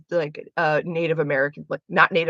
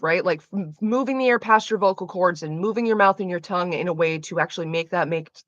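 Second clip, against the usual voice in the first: ordinary talk, nothing marked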